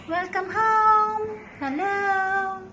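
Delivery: high sing-song coo to a new kitten. Real, not acted. Owner welcoming the new kitten home, high cooing baby talk.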